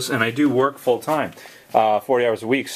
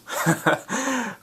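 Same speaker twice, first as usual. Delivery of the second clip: high-pitched laugh; 13 semitones higher, 4 dB quieter than usual. Real, not acted. Laughs at three weeks' work.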